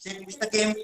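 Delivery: faint voice on a dying mic; very soft, far from the mic; barely audible. Real, not acted. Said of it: "your voice is not audible".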